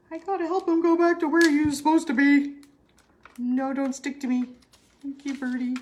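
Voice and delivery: put-on high, quick baby-talk to the birdie; cutesy voice to a bird. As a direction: coo at the little bird like a pet. Talking to the bird.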